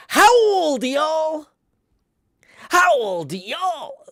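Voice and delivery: "howl" drawn out high and loud like a wolf; howled wordplay. Pretend. Trying a viewer's suggested catchphrase, howling it playfully. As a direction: howl the words, rising at the end. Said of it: "What if yours was just you saying".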